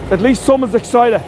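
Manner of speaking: high-pitched, excited cheer; real excitement. Real, not acted. Guide responds to a viewer's comment, excited. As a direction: cheer on the keen one. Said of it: "I'm always excited".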